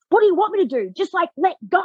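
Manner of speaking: high, exasperated mock protest; imitating frustrated man. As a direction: throw his question back in exasperation. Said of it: "getting so frustrated".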